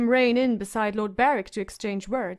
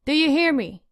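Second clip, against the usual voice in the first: sharp call; 5 semitones higher, 5 dB louder than usual. A narrator's reading. Septa raps sharply.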